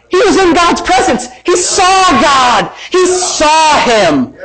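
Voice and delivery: high shouted exclamation with stretched words; emphatic preaching cry. Real, not acted. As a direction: cry it out slowly in disbelief.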